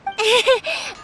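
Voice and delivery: light embarrassed giggle; sheepish laugh. Acted. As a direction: laugh off the scolding. Laughs at being scolded.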